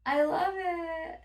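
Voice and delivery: high, slow, teary gush; gushing lady. Retold or imitated. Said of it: "the lady was like".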